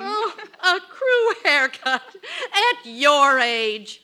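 slow words through laughter; laughing. Acted. Wife laughing at her husband's crew cut. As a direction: laugh as you tease him.

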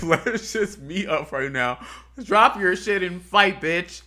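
loud, high, slow mock challenge to fight; playful loud dare, pitched high. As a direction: yell it like you're calling her out, half joking. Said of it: "it was like".

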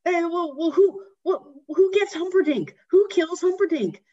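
put-on high, loud, indignant child voice; upset little boy. Boy to grandpa about Humperdinck, high whiny protest. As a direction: protest like a kid who wants justice. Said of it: "and he says".

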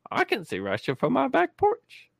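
high-pitched politician impression, drawn to a rising squeak; Sarah Palin. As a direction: do a mocking, high-voiced catchphrase impression. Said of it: "Sarah Palin?".

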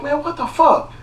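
high, incredulous what-the-hell; exasperated outburst. Retold or imitated. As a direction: throw up your hands in disbelief. Exasperated retold reaction.

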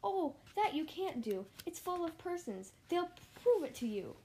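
high, urgent pleading; alarmed protest. A narrator's reading. Elephant defending the tiny people from boiling, alarmed.

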